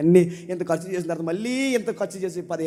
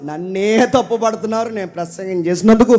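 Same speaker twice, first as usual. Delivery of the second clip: loud, impassioned pulpit preaching; full-force preaching; 6 semitones higher, 13 dB louder than usual. Acted. Preacher pleading loudly to his congregation, impassioned. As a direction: thunder the prophet's complaint at the congregation.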